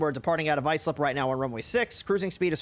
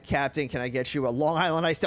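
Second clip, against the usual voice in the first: ordinary talk, nothing marked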